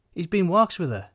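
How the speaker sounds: insistent wife. A narrator's reading.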